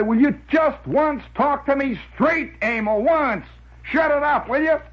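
high-pitched mock exasperation; exasperated outburst. Imagined exasperated outburst at the child.

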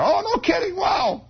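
put-on high shouted boy's glee; excited kid shout. Boy shouting his delight at gossip. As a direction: yell it like a thrilled kid. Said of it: "Well, I'm laughing about it".

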